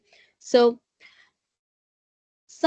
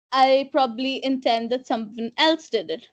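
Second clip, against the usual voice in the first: ordinary talk, nothing marked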